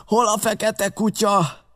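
high, slow alarmed cry; captain's cry. A narrator's reading. Dazed captain cries out for his enemy, slow and high. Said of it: "fölkiáltott".

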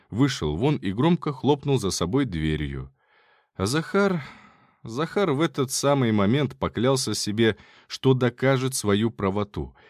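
ordinary delivery, nothing marked

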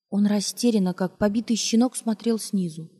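usual speaking voice

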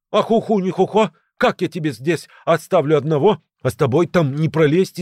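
high, agitated protest, voiced; frightened. A narrator's reading. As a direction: protest in panic, pitched well up. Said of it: "испуганно прошептал Грыжа".

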